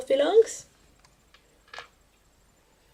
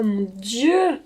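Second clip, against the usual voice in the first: ordinary talk, nothing marked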